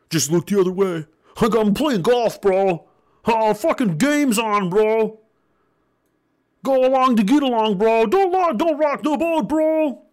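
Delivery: high, dismissive dudebro voice; high mock bro, sneering. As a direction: lazily brush it off like a bro. Mocking uncaring parents as bros.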